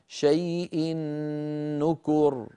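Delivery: "شيء نكر" joined by a long doubled humming n; tanween into noon. Shown as a demonstration. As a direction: stretch the nasal n before "نكر". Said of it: "أدغمنا التنوين".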